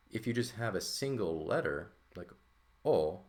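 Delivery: ordinary delivery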